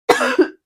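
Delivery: loud chesty cough from bronchitis; a sudden hard cough; coughing fit. Real, not acted. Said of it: "sorry if I keep coughing".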